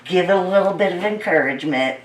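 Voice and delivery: normal talking voice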